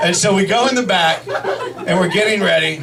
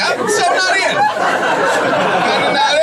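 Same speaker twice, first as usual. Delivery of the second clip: adamant refusal; 10 semitones higher, much slower than usual. Retold or imitated. Acted refusal of the scary version.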